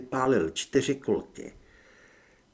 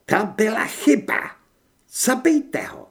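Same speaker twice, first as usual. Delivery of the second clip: high, loud angry woman; high-pitched villainess fury; seething with rage; 9 semitones higher, 5 dB louder than usual. A narrator's reading. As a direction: give a furious order to kill. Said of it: "hlas překypoval vztekem".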